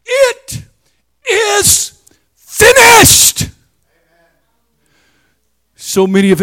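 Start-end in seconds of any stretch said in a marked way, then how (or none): 0.1-3.5 very high, loud, drawn-out cry; triumphant high cry It is finished; cried out. Retold or imitated. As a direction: cry it out word by word. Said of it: "he cried out".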